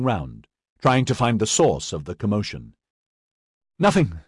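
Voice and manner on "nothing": breathless. A narrator's reading. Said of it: "Edyard said breathlessly".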